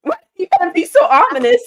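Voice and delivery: high, loud incredulous complaint; mock exasperation. Host retells reaction to a cryptic text in high exasperation. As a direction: protest the ominous message, pitch climbing. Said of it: "I was like".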